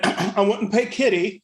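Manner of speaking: ordinary talk, nothing marked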